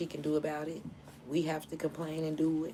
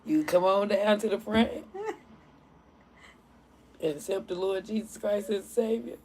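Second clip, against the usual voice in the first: louder, higher preacher call; mock preaching; 5 semitones higher, 7 dB louder than usual. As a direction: preach the invitation to the whole church.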